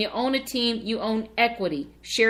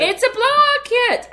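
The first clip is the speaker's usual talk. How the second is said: high excited insistence; 14 semitones higher, 6 dB louder than usual. Real, not acted. Defending the phrase, laughing.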